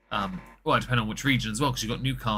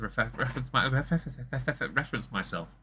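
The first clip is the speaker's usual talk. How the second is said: stammering over one word; tripping repeatedly over "reference"; real stammer; 2 semitones higher, 3 dB quieter than usual. Real, not acted. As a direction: stumble and restart on "refer". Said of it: "developed a bit of a stammer".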